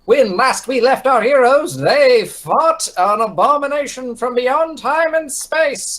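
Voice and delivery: high, grand storyteller voice; epic recap narration. Acted. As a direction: announce it like a fantasy epic. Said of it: "I'll do a recap".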